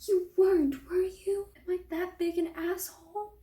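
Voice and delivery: high-pitched, dismayed questioning; incredulous dismay. Acted. Olive realising Ethan was really proposing, incredulous dismay.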